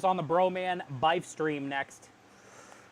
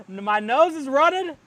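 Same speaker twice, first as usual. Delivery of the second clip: laughing; 3 semitones higher, 5 dB louder, slower than usual. Real, not acted. Laughing at own burning nose.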